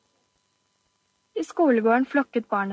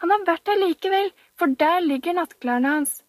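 higher, triumphant insisting; high, triumphant child; 7 semitones higher than usual. Acted. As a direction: prove your point gleefully. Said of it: "ropte Camilla triumferende".

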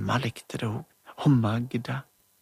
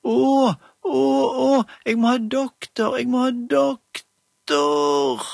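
put-on much higher, louder wailing old grandma; sick grandma voice; 10 semitones higher, 5 dB louder, slower than usual. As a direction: moan like a poorly grandma doll. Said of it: "Hun var svært lik Magda i stemmen".

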